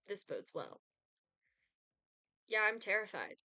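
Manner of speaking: dry sarcastic "terrified"; mock fear, said with heavy sarcasm; deadpan sarcasm aimed at the Grim Reaper. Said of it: "I read that with so much sarcasm".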